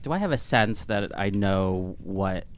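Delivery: usual speaking voice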